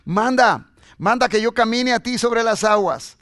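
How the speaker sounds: loud, insistent call. Acted. Peter begs Jesus to command him onto water, loud call. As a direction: shout the plea as Peter.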